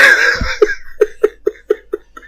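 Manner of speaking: breathless shrieking laugh; high squealing laugh. Real, not acted. Laughing at the reunion.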